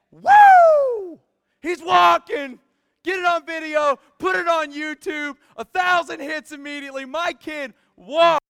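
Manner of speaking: loud, high whooping celebration; ecstatic proud parent. Acted. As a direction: cheer like your baby just took first steps. Parents cheering baby's first steps.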